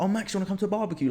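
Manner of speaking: quick, high, eager mum invitation; super enthusiastic. Retold or imitated. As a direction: mum bursting with cheer about the barbecue. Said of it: "super enthusiastic".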